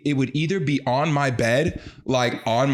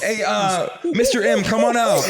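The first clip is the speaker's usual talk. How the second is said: laughing mock host intro; talk show host; 7 semitones higher than usual. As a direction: welcome the guest with showy fanfare. Introducing Mister Monkey as guest.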